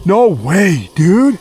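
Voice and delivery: high, loud cry of disbelief; seeing a second giant print. Real, not acted. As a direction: shout it in disbelief.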